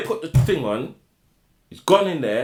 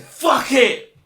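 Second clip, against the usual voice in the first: high, loud vengeful shout; acted-out furious outburst; tearful fury; 7 semitones higher, 6 dB louder than usual. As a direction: yell it like someone wound up to bursting. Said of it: "He had tears in his eyes".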